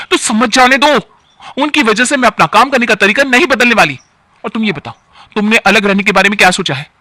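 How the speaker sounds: loud and high, digging in her heels; defiant anger. Acted. Wife rebels against husband over the aunt, loud and high. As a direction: snap back and refuse to back down.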